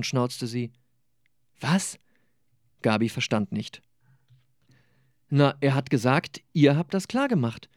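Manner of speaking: lebendige Romanlesung mit gespielten Figuren; Lesung. Acted. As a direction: lies die Szene wie bei einer Lesung vor Publikum. Autor liest aus seinem Roman. (Autor liest vor Publikum aus seinem Roman, lebhaft vorgetragen)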